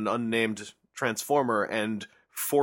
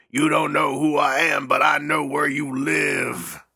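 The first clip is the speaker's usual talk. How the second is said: raised, drawn-out mock menace; mock stalker; 2 semitones higher, slower than usual. Playing a listener who stalks the host, mock menace. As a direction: stretch it out like a hammy creep.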